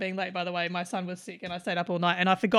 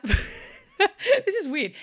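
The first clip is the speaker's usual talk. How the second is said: laughing; 9 semitones higher, much slower than usual. Real, not acted. Laughs at eating mythical beasts.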